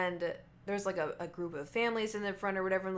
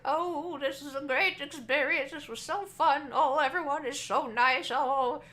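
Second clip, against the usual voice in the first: put-on higher, gushing old-man voice; happy old man; 7 semitones higher, 5 dB louder, slower than usual. Old man thanking the waitress on his way out, gushing. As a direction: gush like a delighted old man leaving dinner.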